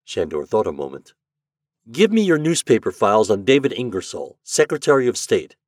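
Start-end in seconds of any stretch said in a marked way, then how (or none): 1.9-5.6 a brisk, businesslike man, higher and louder; crisp and direct, giving orders to a machine; Shandor's voice. A narrator's reading. Shandor asks the library index for files, brisk.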